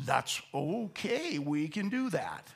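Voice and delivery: slow, soft, calm reassurance; gentle reassurance. Retold or imitated. Holy Spirit reassuring a believer, slow and gentle. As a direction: settle them down gently.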